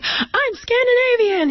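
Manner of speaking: put-on very high, slow, beaming child voice; proud happy boy. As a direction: imitate a delighted little boy announcing it. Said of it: "He was very, very happy".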